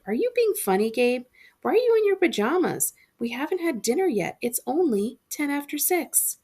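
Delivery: a mother laughing at her son's joke; amused mom. A narrator's reading.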